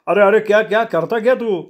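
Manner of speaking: high-pitched alarm; panicked. Acted. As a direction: protest in a frightened, rising panic at the knife. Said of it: "त्याची बोबडी वाळल्यासारखी झाली". (घाबरून, चढ्या आवाजात)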